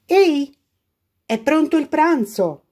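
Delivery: high, loud call to lunch; mother calling her daughter to lunch; mum calling. A narrator's reading. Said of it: "la invitava la mamma".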